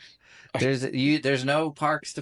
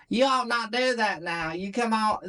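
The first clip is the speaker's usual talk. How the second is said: put-on high-pitched child's Southern accent; little Southern kid; 8 semitones higher than usual. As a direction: scold like an adorable little Southerner. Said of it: "little kid talking".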